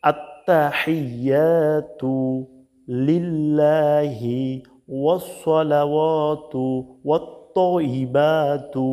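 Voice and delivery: long drawn-out syllables, measured recitation; slow Quranic recitation. Shown as a demonstration. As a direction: recite it slowly, stretching every long vowel. (ধীরে টেনে টেনে তিলাওয়াত)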